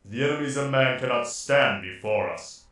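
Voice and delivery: loud, distorted commanding soldier; Space Marine voice. Shown as a demonstration. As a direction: boom it out like an armored warrior. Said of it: "That's a bit better".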